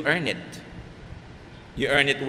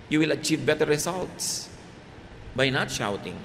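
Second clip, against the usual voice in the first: ordinary talk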